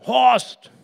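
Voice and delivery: loud, high call of a name. Retold or imitated. Confused neighbour calling for her husband, loud high call. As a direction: bellow the name across the ward. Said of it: "hat jemand nach mir gerufen".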